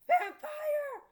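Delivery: loud, high scream of fright; frightened cry. Acted. Girl screams on seeing a vampire in her room. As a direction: shriek at the fangs. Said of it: "I cried".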